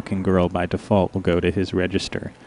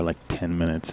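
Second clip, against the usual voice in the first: ordinary talk, nothing marked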